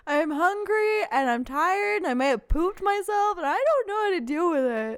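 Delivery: a hint of a helpless little one complaining; whining baby. Acting out a needy baby.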